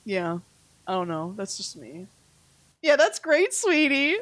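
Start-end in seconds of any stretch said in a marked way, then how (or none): 2.8-4.2 bright, higher, sing-song brush-off of someone; fake sweet agreement. Brushing off a friend with fake sweet agreement. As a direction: pretend to agree while tuning them out.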